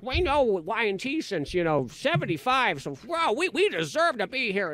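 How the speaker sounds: high-pitched imitation of territorial ladies; mock entitlement, pitch pushed up. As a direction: play indignant concert regulars guarding their spot. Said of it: "they were territorial".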